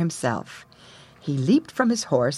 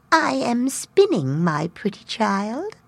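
put-on slow, higher old crone; old woman voice; 5 semitones higher, slower than usual. A narrator's reading. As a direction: creak it out slowly like a granny. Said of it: "the old woman".

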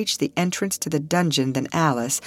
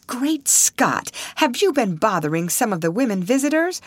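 raised-pitch soldier's exclamation; startled cry; 8 semitones higher than usual. A narrator's reading. Soldier to old man about bothering visitors, mildly startled cry. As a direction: cry out in higher-pitched alarm. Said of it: "cried the soldier".

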